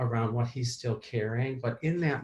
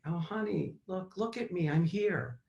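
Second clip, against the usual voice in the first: tender reassurance; 6 semitones higher than usual. Retold or imitated. Soothing scared inner child.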